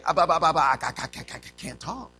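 stuck, repeated syllables trailing off soft; sputter, then quietly give up; Moses stammering. Acted. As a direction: imitate a tongue-tied stutter. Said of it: "Did not Moses say".

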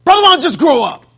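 loud, high, spiteful bark; mean, spiteful. Retold or imitated. Old Brother Dunn hollering at him, spiteful and loud. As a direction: snap it like a mean old deacon. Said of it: "he said it mean".

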